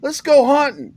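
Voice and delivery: high, loud Southern buddy imitation; hammed-up Southern invite, pitched high; Southern friend. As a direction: call out like a rural Virginia pal. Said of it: "he's like".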